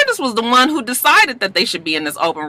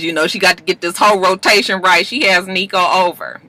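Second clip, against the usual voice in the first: normal talking voice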